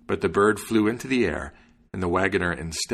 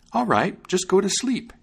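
high-pitched little bird character; high, indulgent bird; obliging sparrow; 12 semitones higher than usual. A narrator's reading. Sparrow agreeing to dog's nap.

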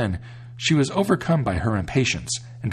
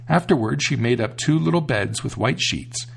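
ordinary delivery

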